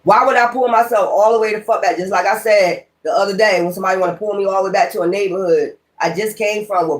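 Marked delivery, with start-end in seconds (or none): none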